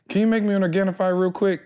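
high put-on voice for his wife's sweet request; voicing his wife. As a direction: mimic the wife asking nicely, pitched high.

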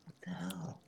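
breathy whispered what the hell; whispering. Real, not acted. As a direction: hush it under your breath. Whispered aside.

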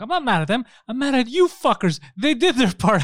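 high, loud exasperated rant; angry retort. Retold or imitated. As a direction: retell an irate customer's complaint. Retells angry complaint to Uber agent.

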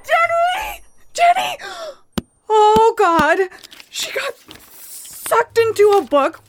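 frantic high screams; panicked screaming. Acted. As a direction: scream her name in panic. Friend vanished into book.

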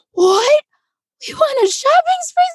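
gasp, then high squealed disbelief; stunned delight. Retold or imitated. Kid learning of the Target shopping spree, stunned delight. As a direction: re-enact a kid floored by a surprise prize. Kid's shocked reaction to prize.

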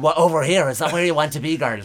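put-on loud, brash Dublin cabbie calling out to posh girls; Irish taxi driver. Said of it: "Irish taxi driver".

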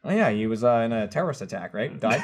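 low, deadpan matter-of-fact retelling; totally straight-faced. Retold or imitated. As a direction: say the absurd thing completely straight. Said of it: "just, like, total straight".

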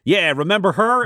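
high, teasing jab; smug stripper. Acted. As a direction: taunt him before the slap. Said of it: "And Jack Macon says".

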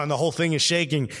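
ordinary talk, nothing marked

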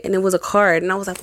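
ordinary talk

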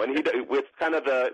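normal talking voice

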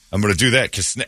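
ordinary talk

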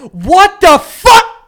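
loud slow outraged yell; mock rage yell. Friend acting out his own rage quit with a yell. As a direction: bellow it, a gamer raging. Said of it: "You cheer".

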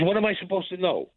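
exasperated bafflement. Retold or imitated. Young man protesting to father's associates, baffled. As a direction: retell a baffled protest, pitch lifted. Acted baffled reply to older men.

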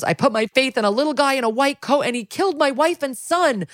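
high, impassioned retold grief; imitating upset crying. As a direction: act out a grieving man's outburst. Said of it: "he's getting upset. He's crying".

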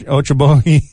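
Ochobogi attempted with an och sound; unsure Ochobogi with a ch for the k; stumbled pronunciation. Real, not acted. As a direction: trip over the place name as Ochobogi. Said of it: "how do you say that?".

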